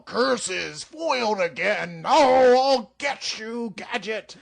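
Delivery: loud hammy cartoon villain cry. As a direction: ham it up like a Saturday morning baddie. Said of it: "the bad guys are all".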